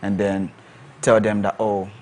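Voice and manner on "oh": ordinary delivery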